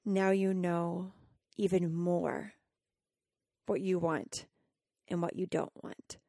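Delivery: slow, soft voice of the universe; inner voice. Retold or imitated. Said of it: "And I hear".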